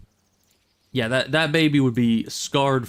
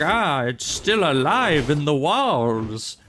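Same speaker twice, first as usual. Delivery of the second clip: slow, hammed-up odd accent for the TV investigators; mock the show's investigator with a strange put-on accent; weird investigator accent; 4 semitones higher, slower than usual. Mocking a medical show's investigators, slow odd accent. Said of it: "I don't know why I sound like this".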